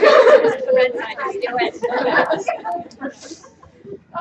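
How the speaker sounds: loud, high, long nervous laugh; embarrassed laugh. Real, not acted. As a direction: laugh off the tears. Embarrassed laugh after tearing up.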